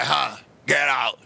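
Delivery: low, slow, shouted stern dismissal; deep, drawn-out mock father's command. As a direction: throw him out like a stern old kung fu film father.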